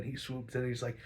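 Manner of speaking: usual speaking voice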